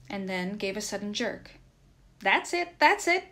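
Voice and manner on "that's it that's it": high, loud, excited cheer; raised urgent cries of encouragement; crying out encouragement. A narrator's reading. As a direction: cheer on a struggling horse. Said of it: "cried Nikita".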